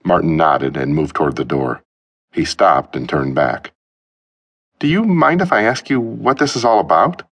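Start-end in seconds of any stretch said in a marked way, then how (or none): 4.8-7.2 put-on nervous young man. Martin's high nervous voice.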